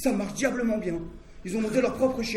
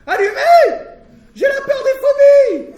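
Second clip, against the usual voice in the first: panicked high-pitched scream, much louder; 16 dB louder, slower than usual. Retold or imitated. The hapless hairdresser screaming in the dark, much higher and louder. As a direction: shriek it out in mock terror. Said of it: "Je l'entends crier".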